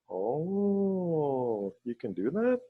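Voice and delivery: long drawn-out "oh", rising question; amazed. Retold or imitated. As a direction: act out his wonder, voice swooping up. Retold amazement at long-distance ceremony.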